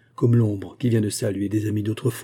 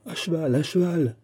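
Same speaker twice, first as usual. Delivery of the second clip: urgent exhortation; 7 semitones higher than usual. A narrator's reading. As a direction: urge him off at once, voice raised in pitch. Urging Fouquet to ride off. (exhortation pressante, voix aiguë)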